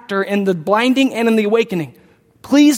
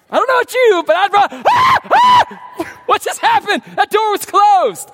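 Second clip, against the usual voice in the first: high, loud playful screaming run; mock panic; 10 semitones higher, 3 dB louder than usual. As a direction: pretend to flee in fright, yelling. Imagining fleeing in panic.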